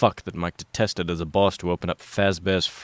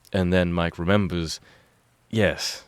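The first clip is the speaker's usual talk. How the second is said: usual speaking voice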